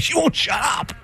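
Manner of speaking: high exasperated cry; exasperated outburst. Retold or imitated. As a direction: blurt it out, fed up. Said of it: "I'm like".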